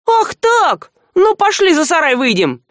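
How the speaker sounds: high, heated call to step outside; bristling thief picks a fight; fighting challenge. A narrator's reading. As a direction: dare him to fight.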